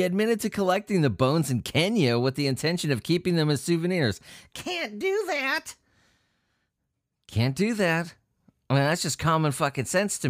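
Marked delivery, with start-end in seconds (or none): 4.5-5.8 a high, stretched-out sing-song of a scolding; high, slow mock scold. Mocking the souvenir collector, high and slow. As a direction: drag it out high like scolding a child.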